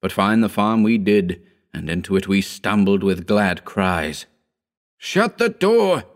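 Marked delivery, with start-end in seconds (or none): 5.0-6.0 high, drawn-out shouted order. A narrator's reading. Howard ordering his friend inside the farm, urgent shout. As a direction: shout it slowly, pitched far up. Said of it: "shouted Howard".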